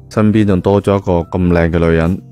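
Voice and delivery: usual speaking voice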